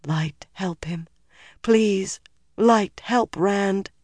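slow, hushed plea rising out of a whisper; slow, grieving entreaty; whispered prayer. A narrator's reading. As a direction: pray slowly in anguish. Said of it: "she whispered".

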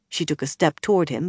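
ordinary delivery, nothing marked

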